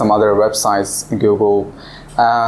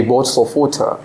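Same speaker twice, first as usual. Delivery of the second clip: Queen's English with the T in "water" sounded; Queen's English accent. Shown as a demonstration. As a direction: pronounce "water" the Queen's way, crisp T. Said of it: "is actually Queen".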